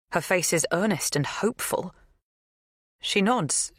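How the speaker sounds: plain reading voice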